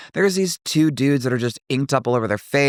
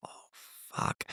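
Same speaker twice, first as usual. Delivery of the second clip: very soft, voiceless mutter; hushed dread; 17 dB quieter than usual. Retold or imitated. Two guys looking them up and down, hushed dread. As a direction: let it out slowly under your breath. Dread as men stare again.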